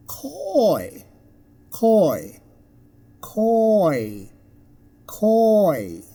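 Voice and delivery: drawn-out "coy", falling at the end; gliding vowel example. Shown as a demonstration. Teacher repeats an example word, "coy" elongated. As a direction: stretch the oi glide in "coy". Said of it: "As in".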